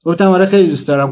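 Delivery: ordinary delivery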